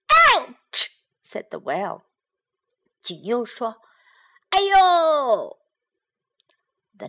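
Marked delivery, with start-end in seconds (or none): 0.1-0.9 very high, loud pained cry; hurt well; crying yelp. Acted. The well is hit again. 4.5-5.6 high, loud pained wail; 哭腔痛呼; crying yelp. Acted. The well is hit again.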